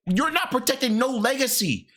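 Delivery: ordinary talk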